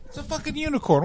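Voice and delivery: high-pitched exasperated rant; incredulous outburst; excited. Real, not acted. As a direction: squeal it like it's obvious. Excited ranting answer.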